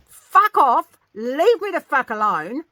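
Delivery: furious full-voiced scream, high and very loud. Mum yelling at a pestering neighbour from her car, furious scream. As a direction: scream it at him, words stretched out. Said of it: "I just started screaming at him".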